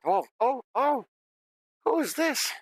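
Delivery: very high-pitched little mimic voice; creature voice. Game master voices Tree the mimic meeting strangers, high creature voice. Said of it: "and he said".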